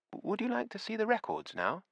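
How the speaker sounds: put-on high, sugary elderly voice; the smiling widow luring him upstairs, high and sweet; sweet old landlady. A narrator's reading. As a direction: coax him like a too-sweet hostess. Missus Beatty appears with key.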